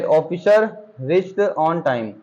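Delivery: slow, deliberate dictation; slow read-out. Shown as a demonstration. Teacher reading the next example to students, slow. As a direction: read "officer reached on time" very slowly, word by word. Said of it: "reads example slowly".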